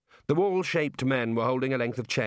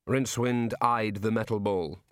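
plain reading voice

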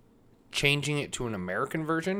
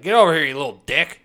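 loud, gruff-tempered father's order; acted mean dad, much louder; asshole dad; 3 semitones higher, 10 dB louder than usual. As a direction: summon your kid with mock contempt. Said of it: "their dad was just an asshole".

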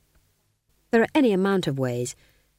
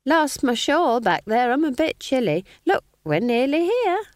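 sly smiling innocence; 8 semitones higher, 4 dB louder than usual. A narrator's reading. Nanny hides laughter.

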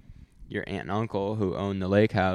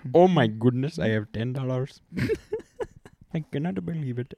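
mock Indian accent; 2 semitones higher than usual. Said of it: "Indian out".

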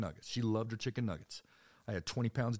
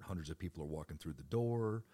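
usual speaking voice

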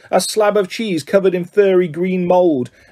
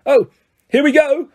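high, exasperated bitter groan of words; 9 semitones higher, 3 dB louder than usual. A narrator's reading. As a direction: let it out high with bitter dread. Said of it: "said Nearly Headless Nick bitterly".

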